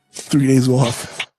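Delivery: slow, hammed-up Long Island accent. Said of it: "Long Island accent".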